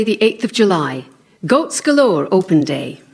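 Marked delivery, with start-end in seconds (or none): none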